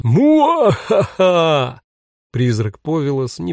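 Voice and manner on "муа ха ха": slow, high, hammed-up evil cackle; a theatrical villain's mwa-ha-ha; evil villain laugh. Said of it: "рассмеялась таким специальным злодейским смехом".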